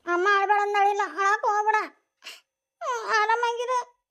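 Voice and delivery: weeping friend begging her not to cry at the farewell. Acted. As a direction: speak through tears, voice breaking. Bride's farewell, crying heard.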